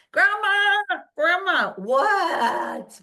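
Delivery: put-on high, loud child calling for attention; little girl tugging at a dozing elder; calling grandma. Little girl calling her great grandma, high and loud.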